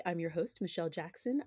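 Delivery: usual speaking voice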